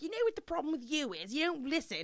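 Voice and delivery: hammed-up English boss telling someone off; English-accented boss. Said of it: "My boss would always be like".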